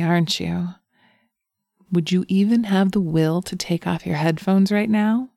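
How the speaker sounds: ordinary talk, nothing marked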